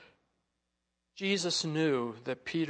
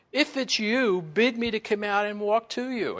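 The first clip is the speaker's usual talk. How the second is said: raised, higher voice calling across water; Peter calling out; 7 semitones higher, 6 dB louder than usual. Retold or imitated. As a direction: call out boldly to someone far off. Retells Peter calling to Jesus.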